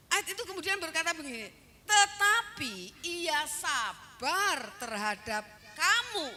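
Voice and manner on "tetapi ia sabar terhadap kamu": slow, drawn-out verse delivery; preacher's weighty, measured quotation; emphatic scripture quoting. Acted. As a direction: declaim the verse word by word.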